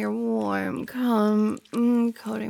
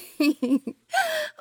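teasing laugh. Acted.

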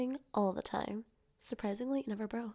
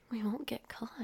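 ordinary talk

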